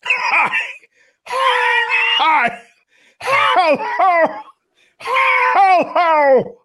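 extremely high, loud laughing squeak; squeaky shout. Acted. Joe squeals hellos at the team. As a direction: squeal each greeting like a cartoon. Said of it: "talking in strange voices".

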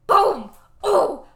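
booming, escalating playful hollers; mock triumphant shouting. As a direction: shout it like a knockout blow landed. Cheering a burn in retold scene.

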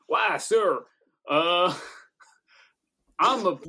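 put-on hammy Southern gentleman accent, breaking into a laugh; Southern accent attempt. Player trying out a Southern accent for the shotgun moment. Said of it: "maybe I'll try the accent".

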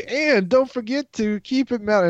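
high pitched catchphrase call. Retold or imitated. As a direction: sing out the sign-off line. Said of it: "I thought you were gonna say".

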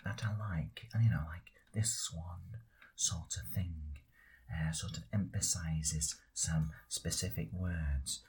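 put-on very low, half-whispered old geezer; low breathy old-timer grumbling about his age; old character voice. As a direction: creak it out like an old man with bad knees.

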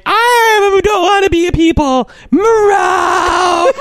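very high crying mock wail; squealed tantrum turning into a cat yowl; hammed-up bawling, then a meow; imitated whining partner. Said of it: "She's like".

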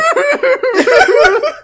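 loud, high hammed-up wailing; uncontrollable mock sobbing. Daughter breaks down at bad news, mock sobbing uncontrollably. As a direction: wail in over-the-top fake sobs. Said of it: "Amy started sobbing uncontrollably".

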